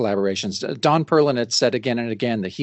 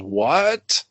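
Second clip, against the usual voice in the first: drawn-out, rising 'what'; startled question. Acted. Redditch turns and looks up at the growl, mock-baffled rising question. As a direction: stretch it upward in mock disbelief. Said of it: "And he goes".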